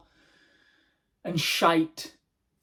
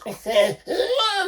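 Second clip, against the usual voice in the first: high burst of laughter; 5 dB louder than usual. Real, not acted. Laugh before dog voice.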